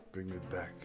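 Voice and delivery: normal talking voice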